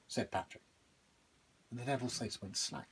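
usual speaking voice

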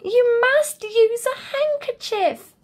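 high, firm telling-off; a bear scolding Badger after the fall. A narrator's reading. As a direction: tell him firmly, up high. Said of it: "cried out loud".

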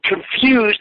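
high mock disbelief; incredulous echo. Echoing the boss's excuse, high incredulous. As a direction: throw the word back at him. Retold quarrel with boss.

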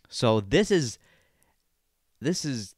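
ordinary delivery

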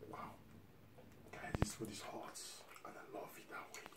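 breathy whisper over hot food. Real, not acted. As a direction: whisper it to the viewers. Hushed aside while eating.

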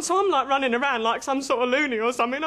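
normal talking voice

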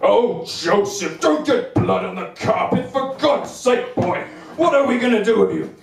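slow, exasperated scolding dad; a hint of a fed-up father telling off; impatient dad. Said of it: "my dad stumbled across me".

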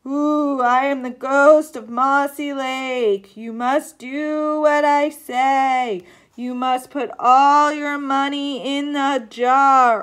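loud, slow, wailing ghost. A narrator's reading. Stage ghost demanding money from the audience, loud drawn-out haunting. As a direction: draw out every word like a haunting spook. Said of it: "the ghost began to speak".

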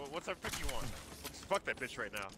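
normal talking voice

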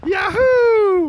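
loud high whoop; excited cheer. Real, not acted. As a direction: cheer loudly as he slides. Cheering kid sledding down.

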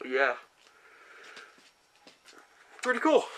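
muffled behind rubber mask; speaking inside full-head mask; through a face mask. Real, not acted. Seen 0: rubber monster mask covers face until removed around 3.3s.

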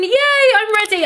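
bubbly ready-reveal; cheery yay. Real, not acted. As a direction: announce it like a big reveal. Cheerful reveal after countdown.

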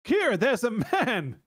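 high, slow mock-alarmed shout with a laugh; hammed-up shout. Host reading the boyfriend's warning to Kira, hammed-up shout. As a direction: call out in exaggerated, amused alarm. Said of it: "he suddenly shouted".

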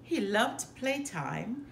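ordinary delivery, nothing marked

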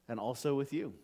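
ordinary talk

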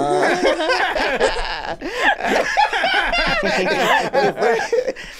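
long high burst of laughter; big laugh. Real, not acted. Host cracks up at the guest's reaction, long high laugh. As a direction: crack up completely.

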